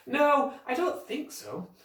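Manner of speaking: Jack drawing it out, unsure; a thinking boy's slow no. A narrator's reading. Jack answering Pam, slow and unsure.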